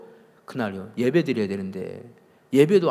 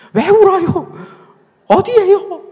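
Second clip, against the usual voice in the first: very high, loud, frantic rapid-fire questions; urgent questioning; 12 dB louder, slower than usual. Retold or imitated. Impatient pastor asking a sobbing woman on the phone, urgent questioning. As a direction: fire off alarmed questions at a shrieking pitch. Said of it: "내가 혼자 계속 묻고 있는 거예요".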